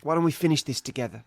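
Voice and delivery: badly faked Australian film-hero voice; Australian accent. Hero urging his enemy to finish it, wobbly Australian accent. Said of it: "Why is he Australian again?".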